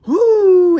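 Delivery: very loud, high owl-like hoot; ghostly whoo. Acted. Awful sound from the attic corner, loud high hoot. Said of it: "an awful sound came".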